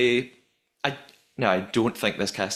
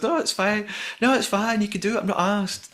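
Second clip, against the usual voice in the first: a higher-pitched, loosely imitated Faraz accent; imitating Faraz's accent; 9 semitones higher than usual. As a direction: lightly put on Faraz's accent, not quite right. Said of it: "That's not his accent at all".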